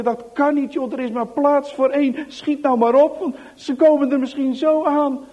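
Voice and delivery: high, loud hurried insistence; urgent pressing. Acted. Anneke urging Johan to hurry into hiding, high and tense. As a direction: push him to hurry, scared.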